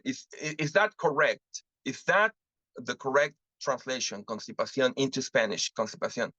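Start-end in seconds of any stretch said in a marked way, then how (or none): none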